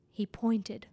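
usual speaking voice

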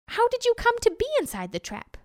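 high-pitched, puzzled jackal; innocent jackal. A narrator's reading. Jackal plays innocent, asks tiger.

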